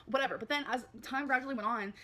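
ordinary talk, nothing marked

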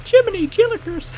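put-on squeaky high nerdy kid; Milhouse voice. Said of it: "That, that Radioactive Man".

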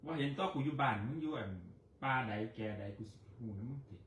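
very soft grumbled threat; muttering. Real, not acted. Grumbling at her partner, quiet mutter. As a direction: mutter it under the breath.

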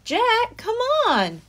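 put-on girl calling her brother from the treehouse. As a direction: call him up the ladder. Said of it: "Annie called down".

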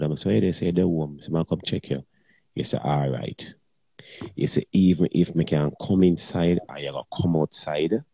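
ordinary talk, nothing marked

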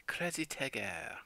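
slow, breathy-free French lilt; copying the French pronunciation again, slowly; French accent. Retold or imitated. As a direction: say it like a Frenchman, drawn out. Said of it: "A French accent".

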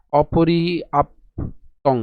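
অপর্যাপ্তং drawn out syllable by syllable; slow, teaching pronunciation; slow syllable demo. Shown as a demonstration. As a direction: stretch অপর্যাপ্তং very slowly, as o-po-ri-ap-tong. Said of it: "তাহলে উচ্চারণ কেমন হবে?".

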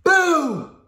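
loud, high playful shout; a teacher reading, shouting to spook the elephants; shouted boo. Acted. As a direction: yell it to startle the herd. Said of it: "at the top of his voice shouted".

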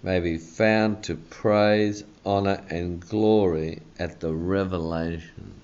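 slow, deliberate reading of the verse; a measured pulpit reading from One Peter; slow scripture reading. Acted. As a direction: read it out slowly with weight.

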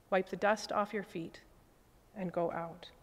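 ordinary talk, nothing marked

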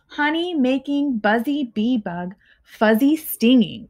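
ordinary delivery, nothing marked

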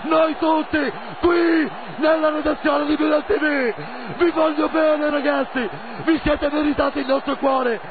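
ecstatic screaming, high and slow; breathless italian goal commentary. Real, not acted. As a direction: scream the last-minute goal. Last-minute equalizer celebration.

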